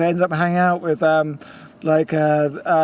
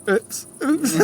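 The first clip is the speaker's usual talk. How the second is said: cheeky oops; 8 semitones higher than usual. Retold or imitated. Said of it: "And I went".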